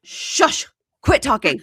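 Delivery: loud, abrupt hush; mock hushing. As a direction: play a man cutting her off sharply. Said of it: "And he goes".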